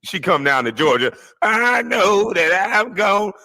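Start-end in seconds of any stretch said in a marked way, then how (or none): 1.4-3.3 slow, higher mock Southern accent; exaggerated country Southern mimicry; fake Southern drawl. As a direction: stretch it out in a phony Southern drawl. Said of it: "that fake ass Southern drawl".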